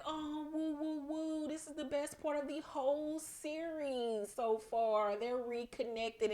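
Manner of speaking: delighted woo. Retold or imitated. A recapper gushing over the mother-daughter reunion, high soft coos. Narrator's own reaction to reunion.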